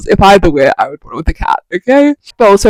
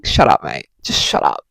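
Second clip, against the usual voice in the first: much lower, gruff cat muttering shut up; grumpy cat voice; 6 dB quieter than usual. Retold or imitated. The reader's cat, ignoring her, telling her off low. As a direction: growl it low as a fed-up cat. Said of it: "she's thinking".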